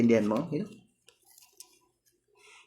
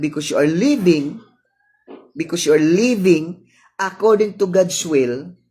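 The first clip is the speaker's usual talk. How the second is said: sermon build; 4 semitones higher, 5 dB louder than usual. Acted. Preaching build.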